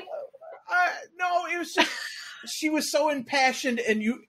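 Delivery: high voice, still cracking up; laughing. Real, not acted. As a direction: try to resume the story through giggles. Teller still recovering from laughing.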